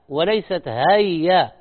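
normal talking voice

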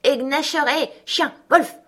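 high, urgent calls to set the dogs on; calling the dogs. A narrator's reading. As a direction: shout the dogs' names up high. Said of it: "cria le vieux".

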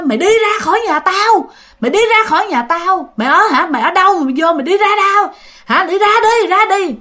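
high-pitched, insistent banishing; imitated fierce ghost, repeating demands high; angry ghost ordering out. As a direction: chase the intruder out of your house. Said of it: "Mà nó gào, nó dữ, nó nói".

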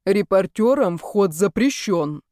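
a hint of a stern doorman; lightly voiced surly doorman; gloomy doorman. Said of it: "Взгляд мужчины стал ещё мрачнее".

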